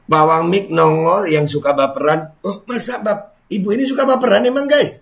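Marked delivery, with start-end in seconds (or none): 2.4-5.0 high, fast, disbelieving; mock surprise. Real, not acted. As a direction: squeak it out in fake shock.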